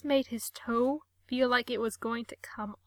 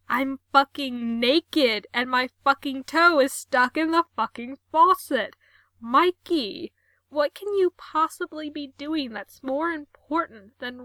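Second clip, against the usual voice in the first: frantic, louder rant; loud protest; 3 semitones higher, 7 dB louder than usual. Acted. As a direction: rant in panic. Gerard protesting his trapped state.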